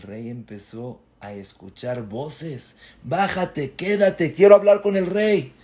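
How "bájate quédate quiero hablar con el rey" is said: urgent plea. Acted. Boy pleads to speak to king.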